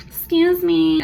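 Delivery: high, loud, sharp "excuse me"; snooty customer call. Retold or imitated. Entitled customer summoning her server from across the floor, shrill.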